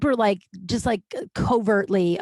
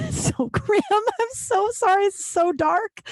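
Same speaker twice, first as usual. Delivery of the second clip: much higher, slow laughing apology; 11 semitones higher, slower than usual. Real, not acted. Podcaster apologizing for her dark bunny story, laughing. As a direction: struggle to get it out through real laughter. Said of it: "I don't know why I'm laughing".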